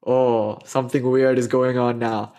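usual speaking voice

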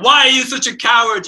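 angry accusation. Retold or imitated. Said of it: "He's like".

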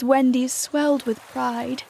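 plain reading voice

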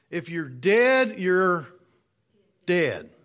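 slow, weighty pause before the last dead; slow build with a long pause before dead; dramatic pause. Shown as a demonstration. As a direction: let the silence hang before dead. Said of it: "Deep, ain't it?".

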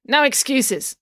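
high, curt scolding; snapped. A narrator's reading. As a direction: shut the excuse down sharply. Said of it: "snapped the cat lady".

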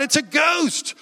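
high-pitched alarmed exclamation; frightened, pitch leaping up; terrified cry. Acted. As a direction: cry out as if seeing a ghost. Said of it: "they were terrified".

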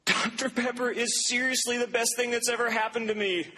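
high, laughing quote of a tearful winner; imitating her tearful joy. Preacher imitating the crying winner on TV, laughing and high. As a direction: squeak it out through laughter like an overjoyed winner. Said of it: "She says".

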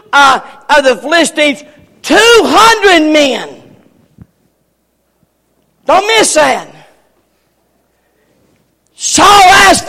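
rising, shouting preacher cadence; fiery preaching. Acted. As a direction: preach it up to a holler. Preaching at full force.

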